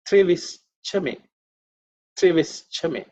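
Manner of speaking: "Trevischame" slow, with a break before "chame"; mantra recitation. Shown as a demonstration. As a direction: recite "Trevischame" deliberately, in separated parts. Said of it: "We look at the next mantra".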